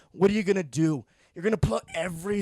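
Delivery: ordinary talk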